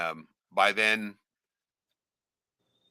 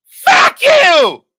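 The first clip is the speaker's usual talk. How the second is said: full-throated furious scream, pitched way up; 19 dB louder than usual. Retold or imitated. Niece screaming at uncle in her mother's shop, full scream. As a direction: scream it at him with everything. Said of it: "Screamed it".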